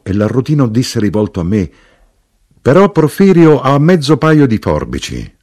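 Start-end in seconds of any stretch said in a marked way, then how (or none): 2.6-5.4 higher, louder character line; annuncio vivace. A narrator's reading. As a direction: pipe up eagerly, high and loud. L'arrotino annuncia con slancio. (battuta vivace, a voce più alta)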